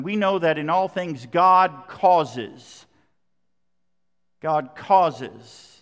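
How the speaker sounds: slow, loud weight on GOD; GOD hit hard, then a falling "causes"; stress on GOD. Shown as a demonstration. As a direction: stretch and punch GOD before "causes". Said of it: "The emphasis is on God".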